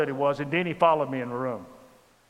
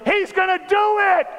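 high, loud, thrilled yell; excited shout; 8 dB louder than usual. Retold or imitated. Denny yelling to the room that he's gonna do it, thrilled. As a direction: shout it out with delight.

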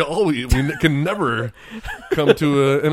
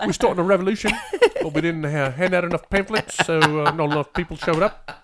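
Korg impression; 3 semitones higher than usual. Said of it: "[name] as Korg".

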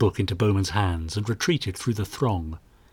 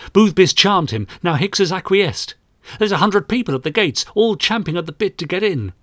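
put-on higher, urgent young sergeant; agitated; 8 semitones higher than usual. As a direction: blurt it out, worked up. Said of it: "Graves' curls bounced on his head in agitation".

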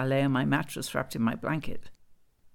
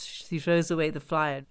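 ordinary delivery, nothing marked

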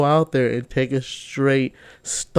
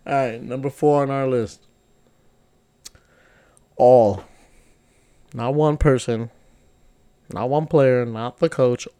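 ordinary talk, nothing marked

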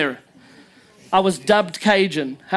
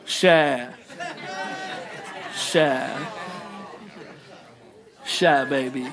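low, softer Cajun drawl on each word; attempted Cajun accent; 7 semitones lower, 7 dB quieter, much slower than usual. Shown as a demonstration. Preacher trying the locals' speech for laughs, Cajun accent. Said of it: "that's one of the accents".

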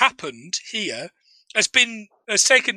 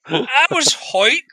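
put-on Northern Irish accent; 3 semitones higher, slower than usual. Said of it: "Northern Irish accent".

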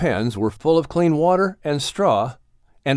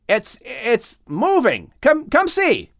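high, slow, breathless with wonder; a son urging his father to come look, excited; 10 semitones higher, slower than usual. A narrator's reading. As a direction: stammer with excitement, voice soaring. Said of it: "replied Ham excitedly".

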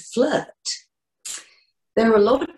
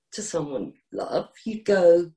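ordinary talk

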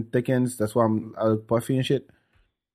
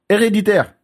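"Hereditaire" in French, higher pitched; French pronunciation; 4 semitones higher than usual. Shown as a demonstration. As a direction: say it the French way, silent H. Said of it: "I can say it in French".

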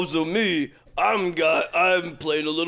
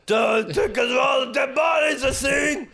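put-on slow, high drunken shout; 8 semitones higher than usual.

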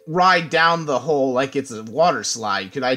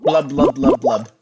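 bubbly gurgling blubs; gurgling fish man croak; 4 dB louder, slower than usual. Pretend. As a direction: blub it out like a fish. Cursed player mimes bubbling speech.

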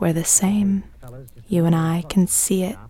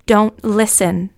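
sharp warning; 4 semitones higher, 6 dB louder than usual. Acted. Diarist voices Dottie's interjection.

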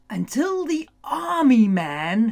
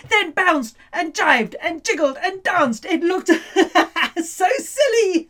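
laughing; 7 semitones higher, 3 dB louder than usual. A narrator's reading. Silly dancing robot scene.

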